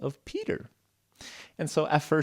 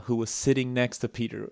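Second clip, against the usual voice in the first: ordinary delivery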